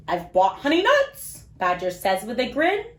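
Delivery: put-on lively, sing-song storybook read for little kids; reading to children. A narrator's reading. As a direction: read it like bedtime story time for small children. Reads picture book to kids.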